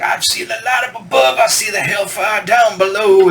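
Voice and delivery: high, ringing preacher boom. Retold or imitated. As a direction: imitate the singer preaching to the crowd. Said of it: "he talked like a preacher almost".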